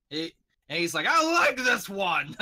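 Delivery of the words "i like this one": hearty in-character laughing, higher and louder; delighted big laugh from a brute; laughing hard. Acted. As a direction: crack up while saying it. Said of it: "He starts laughing his ass off".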